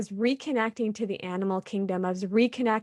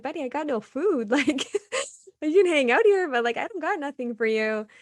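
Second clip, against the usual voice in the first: higher, playful voice for a little animal; talking to a critter; 6 semitones higher than usual. Retold or imitated. Telling the chipmunk on the rock she has no food, playful and higher. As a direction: coo at the chipmunk like a pet.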